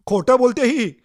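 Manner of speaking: very loud, high angry outburst; shouting. Acted. Accused man shouting at the lying woman, furious. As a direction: bellow that she is lying. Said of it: "तुम्ही ओरडता".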